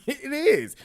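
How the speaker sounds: high, laughing "It is"; laughing insistence. Real, not acted. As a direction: laugh while doubling down. Insisting after calling out Nany.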